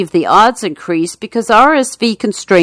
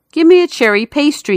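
higher, wheedling con man; sly con man; 7 semitones higher, faster than usual. Acted. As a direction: coax the baker for a pastry. Con man asks the baker.

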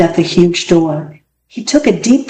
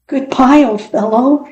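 high, plaintive farewell call; sad calling out; 6 semitones higher than usual. A narrator's reading. Boy calling after his lost dog, sadly. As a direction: call it upward with a touch of sorrow. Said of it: "he called out sadly".

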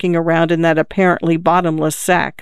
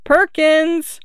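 put-on high childlike calling; drawn-out, high-pitched boyish calls; chirping boys; 11 semitones higher, much slower than usual. A narrator's reading. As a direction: chirp the name like searching boys. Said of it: "a chirping chorus of".

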